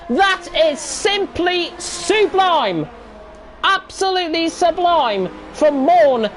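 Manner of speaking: rising, thrilled race call; excited commentary. Real, not acted. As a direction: call the overtake with a roar. Commentator erupts at overtake.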